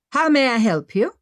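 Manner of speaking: a crisp British hello; crisp British accent. A narrator's reading. Docent greeting visitors at her desk, crisp British accent. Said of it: "in a crisp British accent".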